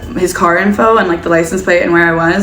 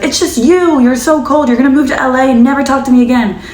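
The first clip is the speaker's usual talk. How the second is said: put-on angry boyfriend; 7 semitones higher than usual. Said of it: "he's like".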